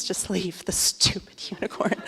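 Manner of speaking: whispered plea, fed up; exasperated whisper. Retold or imitated. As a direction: hiss it under your breath, desperate. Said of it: "I'm like".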